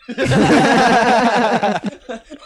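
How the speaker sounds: high burst of laughter. Real, not acted. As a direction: let out a big high laugh. Big laugh after the joke.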